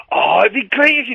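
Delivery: high, excited mock enthusiasm; mimicked gushing. Wife and mates urging him onto Strictly, mimicked high gushing. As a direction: gush like an eager friend, imitated.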